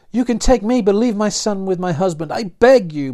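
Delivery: high, loud, desperate plea; begging mother. A narrator's reading. Martha begs the outlaws to spare her son, high and loud. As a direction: beg for your son's sake. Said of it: "Martha cried".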